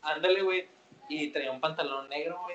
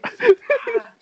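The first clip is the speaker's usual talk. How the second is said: mock pained yelp; 15 dB louder than usual. Hit on the forehead.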